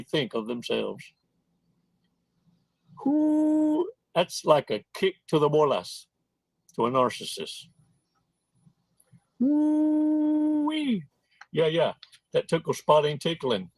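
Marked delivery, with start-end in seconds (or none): 3.0-3.9 long, high "whoo"; drawn-out whoop in reaction. Real, not acted. Exclaims over the kick. 9.4-11.0 long sustained high whoop; drawn-out reacting holler; high woo-wee. Real, not acted. Exclaims over the kick.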